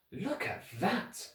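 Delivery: soft, slow and hushed, pointing something out; a quiet, wary look at that. A narrator's reading. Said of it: "Klaus said".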